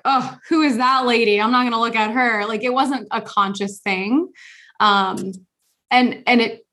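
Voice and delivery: usual speaking voice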